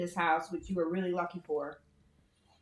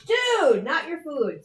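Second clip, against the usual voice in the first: sharp high scolding of the dog; loud, high-pitched "not your food"; 9 semitones higher, 7 dB louder, slower than usual. Real, not acted.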